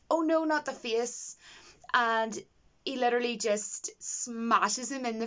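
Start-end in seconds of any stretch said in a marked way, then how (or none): 0.1-1.4 mock droid plea, slow and higher; slowed, squeaky little robot begging; droid pleading. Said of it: "One of the droids went".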